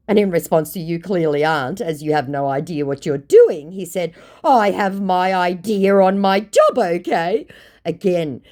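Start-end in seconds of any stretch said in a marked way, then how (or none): 4.4-7.5 indignant scammer. Retold or imitated. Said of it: "that absolute unironic indignation".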